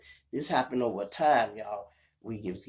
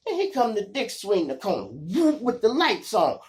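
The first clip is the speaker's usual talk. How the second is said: normal talking voice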